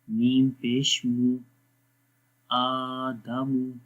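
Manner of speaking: slow, measured recitation on a level pitch; drawn-out, evenly intoned syllables; Quranic spelling drill. Shown as a demonstration. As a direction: chant each letter, vowel and syllable.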